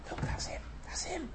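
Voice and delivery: hushed whisper; excited whisper. Retold or imitated. Feast crowd pointing out Lazarus, whispering. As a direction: whisper it to your neighbour. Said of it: "say to one another".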